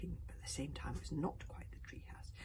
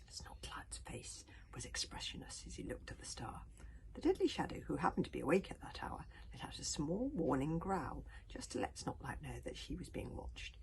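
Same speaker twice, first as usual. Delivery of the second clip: whispered story reading; 2 semitones lower than usual. A narrator's reading. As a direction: read it in a hushed whisper to sleepy children.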